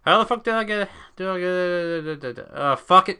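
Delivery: exasperated. Real, not acted. Frustrated at loop.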